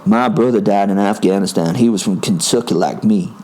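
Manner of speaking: put-on American-accented challenger, steady and level; attempted American accent. Quentin challenging Will to a fight, attempted American accent. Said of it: "I'm gonna attempt an American accent".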